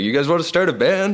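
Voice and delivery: high, loud, eager pitch of the idea; excited invitation. Retold or imitated. Old college friend asking bandmates to start a band, excited. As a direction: pitch it like the best idea ever.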